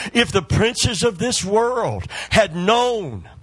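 a rousing sermon climax, loud and high; preaching at full force. Acted. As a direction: drive it home to the congregation, building up.

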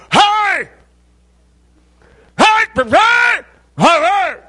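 very high, loud mouthful-of-tobacco yells; imitated garbled hollering. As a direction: holler unintelligibly down from the pole. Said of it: "He would holler down from".

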